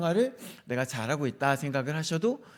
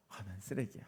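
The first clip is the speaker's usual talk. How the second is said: much softer, deflated self-talk; self-pitying sigh; 8 semitones lower, 15 dB quieter than usual. Retold or imitated. Pastor voicing his own self-reproach, softly and deflated. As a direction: mutter it to yourself, defeated. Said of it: "자괴감이 드는 거예요".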